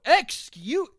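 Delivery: high, loud yelp of protest; startled indignation. Acted. Host acting out being touched from behind, startled yelp. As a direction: exclaim as if touched unexpectedly. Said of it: "randomly come up behind you".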